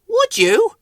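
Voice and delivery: very high, loud hopeful question; high delighted creature voice; Whoops. A narrator's reading. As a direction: squeak it hopefully. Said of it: "said Whoops".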